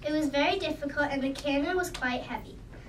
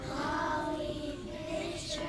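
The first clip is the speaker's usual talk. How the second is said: slow, soft far-off call; distant call; 8 dB quieter, much slower than usual. Acted. Men calling from distance.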